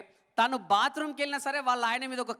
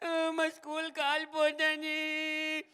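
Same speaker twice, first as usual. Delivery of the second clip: put-on child crying; 6 semitones higher, 3 dB quieter, much slower than usual.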